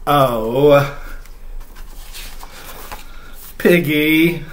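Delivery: reading a picture book aloud, voicing Gerald; reading to children. Dad reads Mo Willems aloud to viewers with Chloe, storytime voice.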